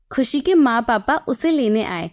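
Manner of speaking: ordinary delivery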